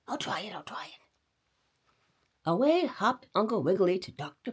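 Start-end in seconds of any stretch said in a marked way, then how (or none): 0.1-1.0 hushed, unvoiced whisper; whispered resolve. A narrator's reading. Rabbit whispers his decision, hushed. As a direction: whisper it to yourself.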